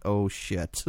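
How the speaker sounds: muttered oh shit. Retold or imitated. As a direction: say it under your breath, caught out. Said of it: "she's like".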